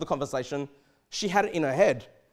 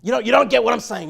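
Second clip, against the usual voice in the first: loud, exasperated mock fool snapping back; frustrated outburst; 2 semitones higher, 9 dB louder than usual. Foolish person irritated at the questioner, loud frustrated retort. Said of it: "They'll get frustrated".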